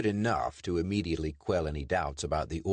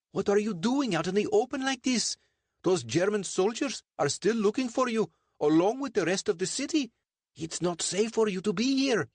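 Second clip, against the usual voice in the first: very high-pitched, worried character voice; Sandeep, urgent warning; 3 dB louder than usual. A narrator's reading. Sandeep warning a friend of German soldiers, high urgent voice. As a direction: warn him urgently, voice pitched right up.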